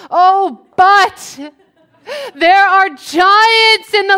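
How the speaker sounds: loud, high, wailing alarm; hammed-up panic. Spies reporting giants in the land, hammed-up panic. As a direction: cry out as if doomed.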